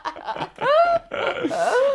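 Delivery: very high, squealing laugh; helpless laughter. Real, not acted. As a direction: laugh hard at your own joke. Laughing at own joke about mother.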